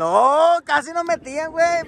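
high, heated exclamation; excited reaction. Real, not acted. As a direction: exclaim over a narrow escape. Reacts to a near goal against. (exclamación de susto futbolero)

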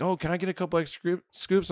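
lightly voiced customer angling for extras; wheedling customer. Sandwich customer asking for extra scoops, wheedling.

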